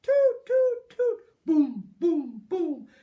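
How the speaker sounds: loud high toots, then booms; loud brass toots and drum booms; band noises. Acted. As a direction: play the tubas and drums with your voice. Elephant band sound effects.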